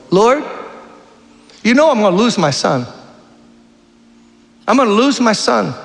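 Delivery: high pleading voice of a desperate mother; praying woman's plea. Retold or imitated. Preacher voicing a weeping mother praying to God, high and pleading. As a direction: beg the Lord like a mother losing her son. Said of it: "here's what she said to the Lord".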